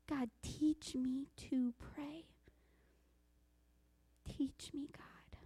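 very slow, soft whisper to God; whispered prayer. Acted. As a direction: breathe the prayer out, fading away.

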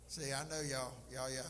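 ordinary talk, nothing marked

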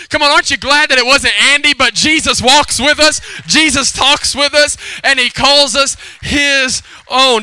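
full-force preaching. Real, not acted. Pastor preaches the punchline to the congregation, loud and exultant. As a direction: proclaim each phrase to stir the room.

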